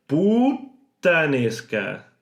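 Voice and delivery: "Puttanesca" very slow, the T held, then released; exaggerated held T. Shown as a demonstration. Teacher exaggerating the double T for learners. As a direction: hold the T in "Puttanesca" long and let it go.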